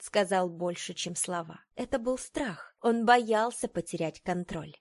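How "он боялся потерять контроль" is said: ordinary delivery, nothing marked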